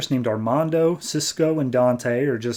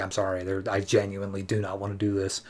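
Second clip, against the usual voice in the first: low, soft, reluctant apology; acted regretful apology; 4 semitones lower, 7 dB quieter, faster than usual. Aquaman refuses his pleading brother, acted regretful apology. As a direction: drop low and quiet, as if truly sorry.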